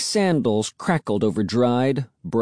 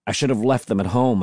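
ordinary delivery

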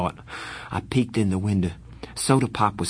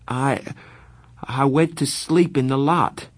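stammering; 5 semitones higher than usual. Acted. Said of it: "when I stammered".

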